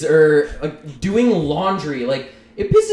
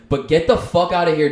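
ordinary delivery

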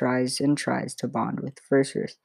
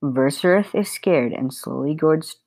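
ordinary delivery

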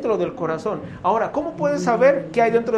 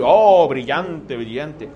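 mock admiration; 5 semitones lower, 4 dB louder, much slower than usual. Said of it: "algunos de ustedes pueden decir".

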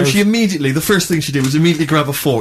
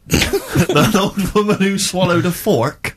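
laughing disbelief; 6 semitones higher, much slower than usual. Real, not acted.

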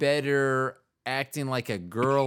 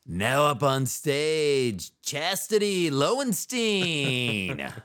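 slow, drawn-out MC introduction; mock emcee bringing a dancer on stage; strip club announcer; 2 semitones higher, much slower than usual.

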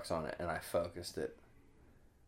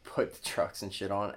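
ordinary delivery

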